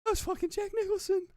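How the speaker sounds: very high, hushed-style mock amazement; starstruck aside. Retelling his reaction once the star passed, starstruck aside. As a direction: keep it small and high, stunned. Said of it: "Like that".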